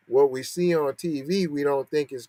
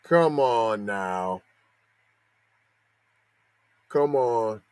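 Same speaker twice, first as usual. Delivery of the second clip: drawn-out exasperation; 5 semitones lower, much slower than usual. Real, not acted. Exasperated at the news.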